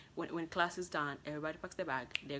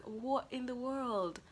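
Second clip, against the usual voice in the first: slow, higher stunned question; stunned by the grade; disbelief; 8 semitones higher, slower than usual. Retold or imitated. Student seeing her grade, slow disbelief. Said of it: "it was like".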